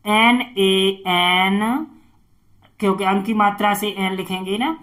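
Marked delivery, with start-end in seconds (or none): none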